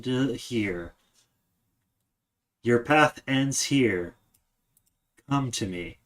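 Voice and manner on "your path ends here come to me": usual speaking voice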